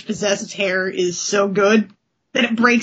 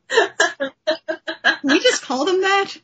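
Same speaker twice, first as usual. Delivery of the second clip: asked high through a laugh; 8 semitones higher, slower than usual. Real, not acted. Cohost laughing while naming the shriek skulls. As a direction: giggle the question out. Laughing at own coined name.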